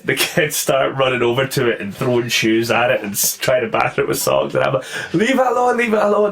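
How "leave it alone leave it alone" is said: high, urgent retold pleading; mock panicked plea. Sensei stopping kids hitting a spider, high mock panic. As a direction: beg them off in mild panic.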